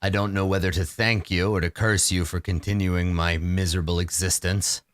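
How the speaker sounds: low, grave vampire lord; brooding vampire. Acted. DM voices a weary vampire to the party, low and grave.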